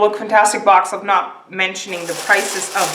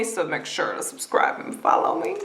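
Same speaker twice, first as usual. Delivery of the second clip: whisper; 5 semitones lower, 9 dB quieter than usual. Pretend. Sneaky aside plug.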